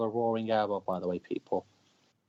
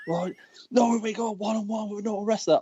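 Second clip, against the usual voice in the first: high-pitched wrestling promo voice; Scott Steiner promo; 9 semitones higher than usual. Retold or imitated. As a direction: rattle it off as a wrestler cutting a promo. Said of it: "doing the whole Scott Steiner promo".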